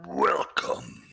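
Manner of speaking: very low, drawn-out greeting; Resident Evil Merchant. Acted. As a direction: drop into a deep game shopkeeper voice. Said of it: "the merchant from Resident Evil".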